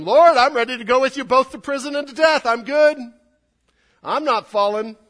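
loud, high, brash boast; boastful Peter. Acted. Preacher playing Peter loudly boasting to Jesus. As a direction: shout it like a cocky disciple. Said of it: "I added a little bit in there".

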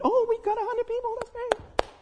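high, sing-song mock voice, pleased with too little; a silly high churchgoer voice congratulating itself; mock smug churchgoer. Mocking complacent churchgoers.

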